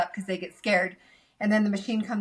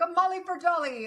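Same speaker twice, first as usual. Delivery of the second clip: higher, lightly voiced Santa muddling names; jolly Santa voice; 10 semitones higher than usual. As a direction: play Santa mixing up the rhyme. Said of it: "he's like".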